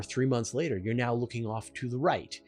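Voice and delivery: usual speaking voice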